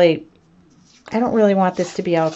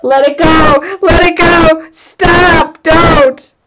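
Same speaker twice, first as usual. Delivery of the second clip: higher, louder mock commands to herself; playful shouting; 7 semitones higher, 6 dB louder, much slower than usual. Real, not acted. Crafter orders herself to leave the page alone, playful shouting. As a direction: yell at yourself to quit fussing. Telling herself to stop fiddling.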